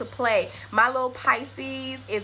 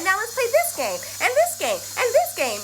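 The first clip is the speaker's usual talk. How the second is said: put-on much higher, eager childlike chatter; excited daughter; 9 semitones higher than usual. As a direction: bounce through it like a tireless kid. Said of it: "she's like".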